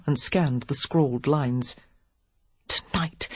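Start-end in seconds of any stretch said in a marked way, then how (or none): 2.7-3.3 whisper. A narrator's reading. Lily reading the note, shocked.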